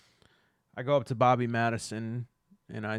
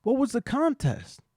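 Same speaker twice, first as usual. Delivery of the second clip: shocked; 14 semitones higher, 8 dB louder than usual. Acted. Shocked follow-up question.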